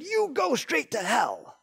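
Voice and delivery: a hammed-up retold outburst from a senator; mock angry senator. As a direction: play the furious senator, each word hammered. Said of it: "one senator got so mad".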